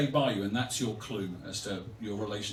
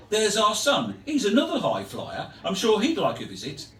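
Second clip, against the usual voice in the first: West Midlands voice, raised then dropping at the end; West Midlands accent; 7 semitones higher, 5 dB louder than usual. Acted. Older couple discussing their absent son, West Midlands accent. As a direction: offer hope in West Midlands tones, then deflate. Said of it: "I'll do the accent".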